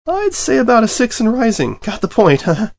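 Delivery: high, nervous, loud voice; nervous squeak. Acted. Dragon facing the angry unicorn, nervous and high. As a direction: squeak it out with a sheepish grin.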